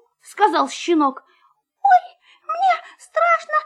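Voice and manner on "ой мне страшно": high, scared mouse squeal; squeaking in fear. Acted. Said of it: "запищал мышонок".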